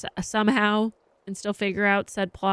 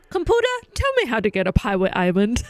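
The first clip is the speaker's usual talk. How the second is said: commanding the computer; 5 dB louder, faster than usual. Pretend. Laughing, she orders her computer about a pirate island. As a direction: bark the order at the computer like a gadget.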